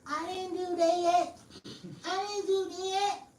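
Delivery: put-on high-pitched, drawn-out child's denial; lying little kid. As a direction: play a little kid whining innocence in a high voice. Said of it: "the little demons tell you the truth".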